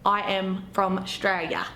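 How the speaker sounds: slow drawn-out Australian accent; broad Australian. Shown as a demonstration. Proud local introducing herself, slow broad Australian accent. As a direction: stretch it out like a true-blue Australian. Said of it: "When you say words like Australia".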